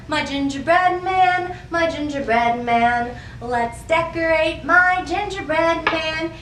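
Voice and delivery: normal talking voice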